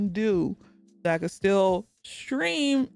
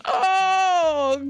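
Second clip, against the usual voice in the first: ordinary talk, nothing marked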